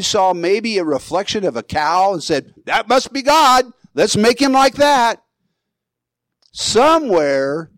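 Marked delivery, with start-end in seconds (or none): 2.7-5.2 high-pitched, hammed-up eureka; acted-out excitement, pitched well up; mock revelation. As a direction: play at having a sudden big idea. Imagines Aaron's sudden bright idea.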